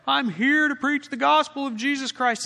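loud, high, brash announcement; hammed-up loud gospel pitch; mock preachy proclamation. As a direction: proclaim it like a street preacher with a flag. Mock missionary waving a flag.

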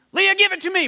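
high, loud furious demand; thundering sister. Acted. Older sister demands makeup from Leah, loud and furious. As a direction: make her hand it over, loud. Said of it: "Brooke thundered".

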